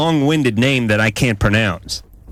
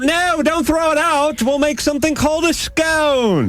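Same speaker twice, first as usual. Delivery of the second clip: hammed-up high-pitched cry of inspiration; mock English cook. Host plays scone inventor saving the milk, mock English cook.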